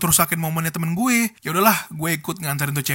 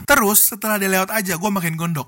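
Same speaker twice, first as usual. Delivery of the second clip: ordinary delivery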